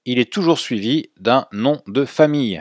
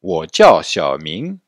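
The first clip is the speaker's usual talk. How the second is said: wo jiao Xiaoming spoken at half speed, a bit louder; slow model phrase; 3 semitones lower, 5 dB louder, much slower than usual. Shown as a demonstration. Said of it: "Répétez après moi". (wo jiao Xiaoming, lentement et articulé)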